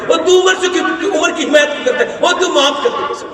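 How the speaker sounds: high-pitched protest; indignant retort. Retold or imitated. Old woman objects to stranger pleading for Umar, indignant.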